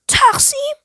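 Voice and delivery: high, sharply rising incredulous question; پرسش ناباورانه. A narrator's reading. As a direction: echo the word as a startled question. Narrator repeats her proposal, astonished. (با تعجب و ناباوری)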